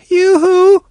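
put-on high, small, plaintive child's call; a little girl answering from far off. Daughter answers her father's call, small plaintive voice. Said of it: "a plaintive little voice came back".

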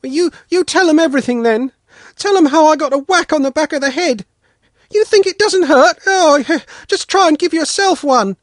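put-on high, loud boy calling from behind a cart; boy calls out from hiding, wounded; aggrieved calling out. A narrator's reading. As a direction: sing out the complaint. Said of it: "sang out from there".

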